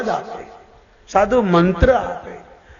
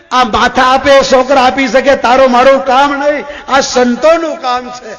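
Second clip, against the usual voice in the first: raised, higher voice of reproach; mild acted rebuke; 5 semitones higher, 4 dB louder than usual. God correcting his devotee Narad, mildly scolding. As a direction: tell the devotee off, louder and higher.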